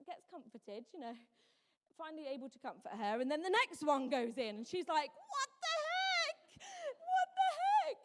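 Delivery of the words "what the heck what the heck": put-on very high, slow, loud baby outrage; indignant infant wailing the words; outraged baby. Baby voiced reacting to jabs.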